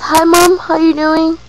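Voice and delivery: high, loud uncle's hello; bright put-on greeting, loud and high; cheerful acted greeting. Uncle greets his mother, retold.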